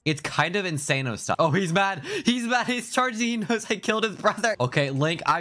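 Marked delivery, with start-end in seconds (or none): 1.4-4.6 rising pitch, the enemy is charging; excited commentary. Real, not acted. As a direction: voice climbing with excitement.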